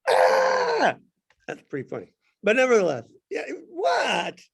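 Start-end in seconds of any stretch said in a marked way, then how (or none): 0.1-1.0 high, sudden, loud yelp; jokey shout as John sees the demon cast out; mock startled yelp. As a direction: yelp like a startled man at an exorcism. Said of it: "John goes".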